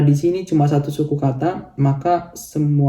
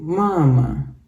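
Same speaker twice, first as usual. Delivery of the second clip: "mama" with the first "ma" pitched up; stress on first syllable. Shown as a demonstration. Teacher showing learners Russian stress, first syllable raised. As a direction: lift the first syllable of "mama", let the second fall. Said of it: "Naik dulu".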